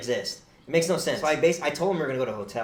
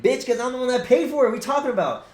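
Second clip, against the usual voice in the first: higher, louder snapping back; fantasy comeback shouted at the host; an angry comeback he never said out loud; imagined angry retort; 11 semitones higher, 7 dB louder, faster than usual. Pretend. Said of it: "I didn't say that".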